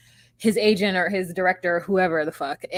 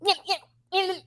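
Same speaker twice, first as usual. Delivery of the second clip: put-on whiny child; 12 semitones higher, much faster than usual. Co-host mimics him fussing like a child, high childish voice. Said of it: "like a child".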